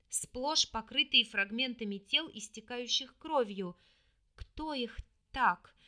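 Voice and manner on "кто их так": slow, trembling frightened question. A narrator's reading. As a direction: ask it shaking with fear. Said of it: "дрожа всем телом".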